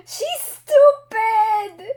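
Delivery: high, loud, slow wail of frustration; frustrated cry. Real, not acted. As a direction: drag it out in exasperation. Reacting angrily to the character.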